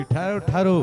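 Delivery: calling out. Acted. King calling after Urvashi.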